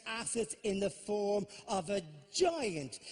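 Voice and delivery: normal talking voice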